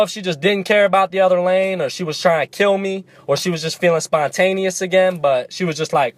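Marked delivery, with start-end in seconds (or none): none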